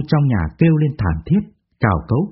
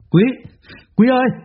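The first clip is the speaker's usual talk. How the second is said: frantic call; 11 semitones higher than usual. Acted. Tài searching the bar for Quý, frantic high call. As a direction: shout for your friend in alarm. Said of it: "Nghe tiếng gọi hớt hải của Tài".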